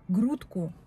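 ordinary delivery

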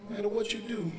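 ordinary delivery

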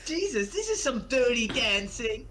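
high, loud, drawn-out exclamation; hammed-up film line. Character awkwardly saying the film's title, hammed-up. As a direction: exclaim slowly in a put-on voice.

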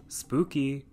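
deadpan sarcastic spooky. Pretend. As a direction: say it with dry sarcasm. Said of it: "sarcasm aside".

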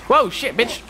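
high, excited outburst; hyped shout. Real, not acted. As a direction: yell it in sudden hype. Hype at game moment.

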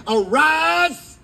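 high, loud rising command; booming angel's arise; angel's shout. Acted. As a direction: call him up like an angel.

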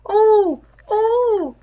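two high loud pained moans; moaning. A narrator's reading. Wounded savage groaning outside the thicket, loud high moans. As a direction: groan out the hurt twice. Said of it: "Someone was moaning outside".

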